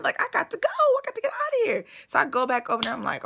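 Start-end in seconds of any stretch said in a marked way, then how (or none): 0.0-1.8 high, squeaky scared voice; mock panic. Telling friends she wanted out of there, frightened high squeal. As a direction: squeal it like you need out now. Deciding to escape the cellmate.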